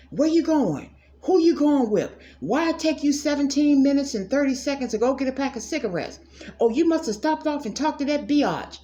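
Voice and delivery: mock a suspicious partner grilling them; nagging jealous partner. Imitating a jealous partner grilling their mate, hammed-up accusing.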